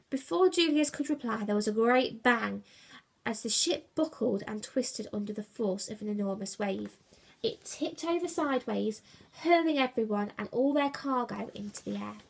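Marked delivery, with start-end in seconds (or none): none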